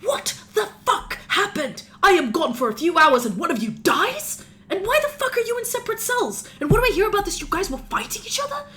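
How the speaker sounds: panicked lawyer losing it at his clients; lawyer freaking out. Acted. Lawyer panics at his clients after one of them dies. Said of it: "he turns towards you and goes".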